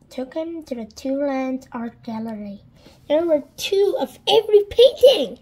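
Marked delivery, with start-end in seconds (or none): 3.1-5.4 amazed. A narrator's reading. Narrator marvels at gallery.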